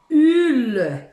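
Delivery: "ul" said loud and full, the l held; syllable demo. Shown as a demonstration. Teacher reveals the reversed syllable with a loud, held "ul". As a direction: belt out "ul" clearly. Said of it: "ça va faire".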